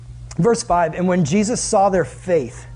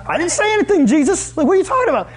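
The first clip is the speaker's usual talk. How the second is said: high, loud squeaky denial; flustered scribe; 11 semitones higher, 3 dB louder, faster than usual. Acted. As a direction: protest your innocence in a high squeak. Scribes denying to Jesus.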